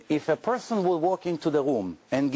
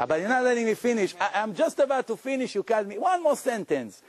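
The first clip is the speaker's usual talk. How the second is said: fast, raised voice, pushing to finish; heated pundit debate; 6 semitones higher, faster than usual. Real, not acted. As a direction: insist on finishing the point.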